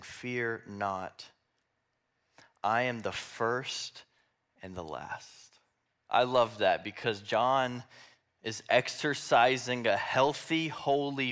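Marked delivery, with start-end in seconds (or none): 0.0-5.6 slow, softly spoken comfort; soft, measured solemn reassurance; gentle reassurance. Acted. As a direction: take it very slowly, sinking to a low, soft close. Reads Jesus calming fallen John.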